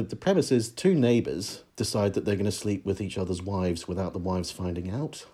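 usual speaking voice

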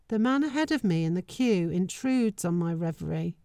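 ordinary delivery

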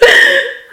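a sudden high burst of laughter; short laugh. Real, not acted. Laughing at the odd word she used. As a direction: laugh out at your own phrase.

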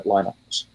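"Linux" said LINE-ux; long i in "Linux", rhyming with line; English-style Linux. Shown as a demonstration. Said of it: "if you were less pedantic".

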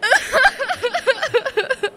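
high, bursting laugh. Acted. Laughter after belt joke.

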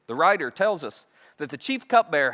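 ordinary talk, nothing marked

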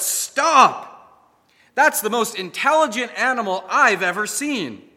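high, loud protest; shouted out. A narrator's reading. Butcher shouting at the owner to stop. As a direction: cry out to stop him. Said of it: "he shouted out".

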